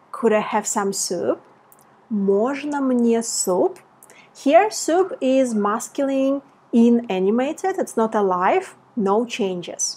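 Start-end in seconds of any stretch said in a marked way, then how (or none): none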